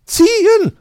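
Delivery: high, loud cheer-on shout; very high, loud command; urging on. A narrator's reading. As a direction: shout them into action. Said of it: "feuerte Frau Elster die beiden an".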